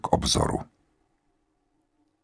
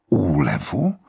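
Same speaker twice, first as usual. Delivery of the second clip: astonished; 4 semitones lower, slower than usual. A narrator's reading. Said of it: "zvolala Vera s úžasem".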